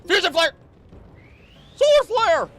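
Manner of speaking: high, loud battle shout; hyped attack callout. Pretend. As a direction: yell the move name like an anime hero. Calling out attack names hype.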